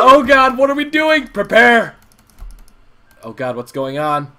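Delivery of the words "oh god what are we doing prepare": loud, high panicky calls; frantic excitement. Real, not acted. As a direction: yell in chaotic alarm. Chased, reinforcements arrive.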